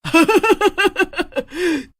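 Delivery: high-pitched rapid cackle; delighted, shrieky witch laugh; gleeful witch cackle. Acted. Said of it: "चुड़ैल खुश हो जाती है".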